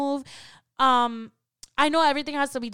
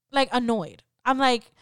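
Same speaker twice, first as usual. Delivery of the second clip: ordinary talk, nothing marked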